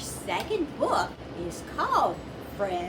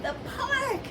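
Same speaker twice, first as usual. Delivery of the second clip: Gorilla's announcement. Acted.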